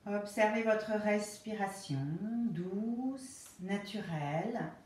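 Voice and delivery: slow, calm Yoga Nidra guidance with long pauses; guided relaxation. Real, not acted. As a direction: ease them into rest, phrase by unhurried phrase.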